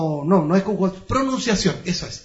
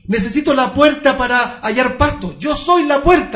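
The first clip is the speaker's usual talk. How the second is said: preaching at full force; 6 semitones higher, 6 dB louder than usual. Acted. Preaching climax.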